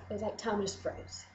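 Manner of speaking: normal talking voice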